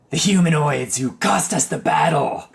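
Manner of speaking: loud gloating fiend calling from the air; spiny devil. Acted. Flying devil taunting the humanoids it fought, monster voice.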